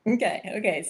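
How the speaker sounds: normal talking voice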